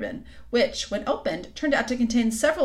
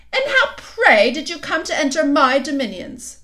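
haughty demand; 7 semitones higher, 8 dB louder than usual. A narrator's reading. Queen interrogating.

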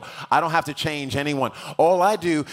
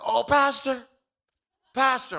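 high-pitched mock calling out; high, eager hail; imitated excited greeting; 11 semitones higher than usual. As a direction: imitate an eager fan spotting his pastor. Gym guy calling out to pastor.

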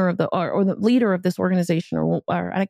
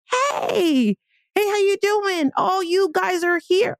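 mock friendly guy voice, pitched up; imitating the guy; 9 semitones higher, slower than usual. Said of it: "And he's like".

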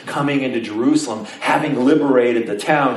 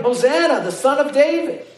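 crowd shouting; 13 semitones higher than usual. Retold or imitated. Everybody hailing the Son of David, imitated shouting. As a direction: echo a crowd's cries in a raised pitch. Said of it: "everybody is screaming and shouting".

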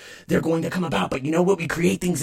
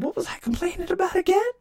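mock self-voice. Voicing himself mockingly.

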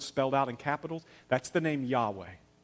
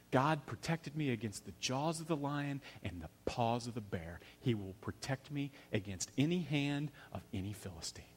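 low-key toughness, soft and steady; strong and tough; 3 semitones lower, 11 dB quieter than usual. Retold or imitated. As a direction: quote it with quiet, hushed conviction. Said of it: "he says it so strong and so tough".

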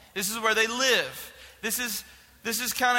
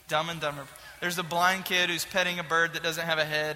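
usual speaking voice

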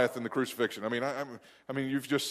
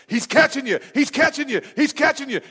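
higher, louder, rapid repeated shouts; retold urgent yell; 12 semitones higher, 8 dB louder than usual. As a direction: yell a warning like a dad in the stands. Dad shouting from the stands.

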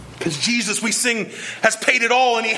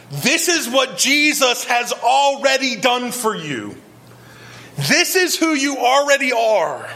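preaching at full force; 6 semitones higher than usual. Real, not acted. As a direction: proclaim it slowly, lifting each line. Preaching at the table, building.

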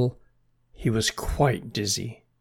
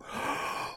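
frightened gasp; 10 dB quieter than usual. Acted. Snail spots the crow.

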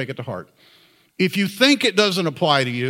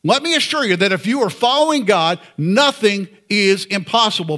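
ordinary talk, nothing marked

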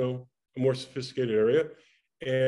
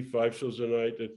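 ordinary delivery

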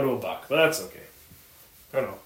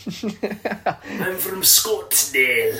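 very slow, higher, laughing mock Scottsdale accent; 7 semitones higher, 5 dB louder, much slower than usual. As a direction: drag it out like a proud fake local through a laugh. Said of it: "the Scottsdale accent".

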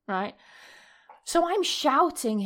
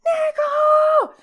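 high, loud, drawn-out yell for someone; shouting; 10 dB louder, much slower than usual. Retold or imitated. Scared woman calls boyfriend wearing headphones, shouting. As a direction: holler the name at the top of your lungs. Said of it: "I'm there shouting my boyfriend".

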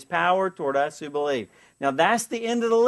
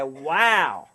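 mock awe; 4 semitones lower, much slower than usual. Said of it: "we'll come away and say".

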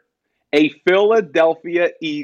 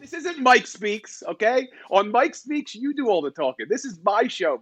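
much higher, exasperated complaint; exasperated protest; 8 semitones higher, 3 dB quieter than usual. Real, not acted. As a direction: protest being cut off. Host protests being interrupted.

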